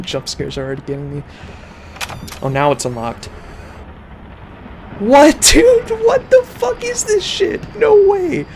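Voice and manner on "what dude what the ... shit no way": very high, loud, slow scared shout; scared horror game streamer. Real, not acted. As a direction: shriek in disbelief.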